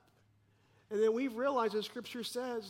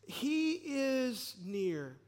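slow emphatic; 5 semitones higher, 3 dB louder, much slower than usual. Acted. Climax of the sermon point.

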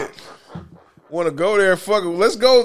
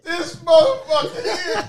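high and slow, laughing till crying; crying with laughter; 11 semitones higher, slower than usual. Real, not acted. Cracking up at friend.

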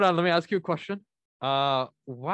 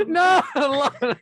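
tag mock dismay; 10 semitones higher, 7 dB louder, slower than usual. Real, not acted. Host playfully despairs at failed attempt.

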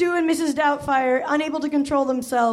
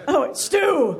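second take at the granny, a bit higher; retried old woman voice; 4 semitones higher than usual. Acted. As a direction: redo the nanny voice more settled. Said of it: "these voices are gonna get right".

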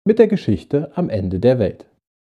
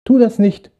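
much higher, louder pleading warning; urgent warning; 10 semitones higher, 3 dB louder than usual. Acted. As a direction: stop him before it's too late. Stars warn little star. (eindringliche, hohe Warnung)

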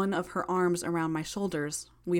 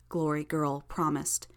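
plain reading voice

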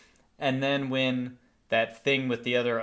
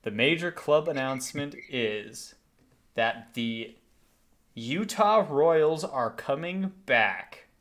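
slow, drawn-out big reveal; stretched-out dramatic proclamation; drum-roll announcement; 3 semitones higher, slower than usual. Real, not acted. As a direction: announce it with suspenseful pauses, lifting into the news. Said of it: "drum roll sound effects in here".

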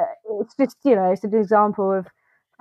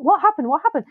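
high-pitched, puzzled replay of panic; bewildered reaction; 8 semitones higher, faster than usual. Retold or imitated. Viewer retelling confusion at the ending, mock bewildered. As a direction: ask it high, as if baffled.

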